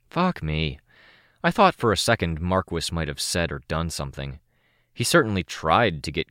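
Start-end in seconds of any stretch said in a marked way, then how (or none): none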